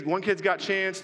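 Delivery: ordinary talk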